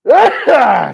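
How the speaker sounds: loud hollering sneeze; a big yelling sneeze; dad sneeze. Real, not acted. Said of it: "I got a dad sneeze happening here".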